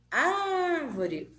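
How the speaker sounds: ÁR in "Árvore" long and high; stress on ÁR. Shown as a demonstration. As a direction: call out "Árvore", stretching the first syllable. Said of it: "antepenúltima sílaba forte".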